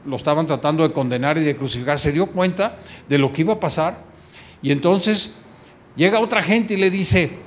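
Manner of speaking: usual speaking voice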